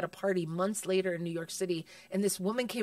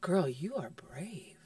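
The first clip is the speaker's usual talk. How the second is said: slow, soft whispered praise; hushed whisper; 5 semitones lower, 5 dB quieter, much slower than usual. Retold or imitated. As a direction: whisper it close during a hug. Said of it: "he hugged me and he goes".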